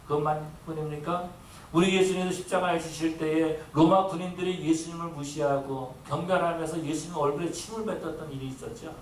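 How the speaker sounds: ordinary talk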